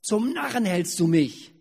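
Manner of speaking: angry shout. Acted. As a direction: bellow it at the donkey. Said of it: "schrie Biliam". (wütend geschrien)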